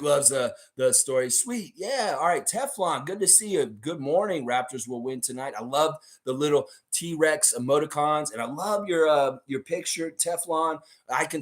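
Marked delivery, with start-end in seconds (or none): none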